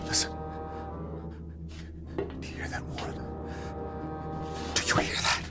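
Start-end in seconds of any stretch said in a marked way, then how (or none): 2.2-5.4 hushed, breath-only whispering; frightened whisper. Acted. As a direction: whisper as something creeps closer. Alone, hearing something approach outside.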